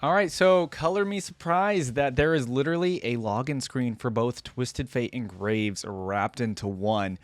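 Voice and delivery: ordinary delivery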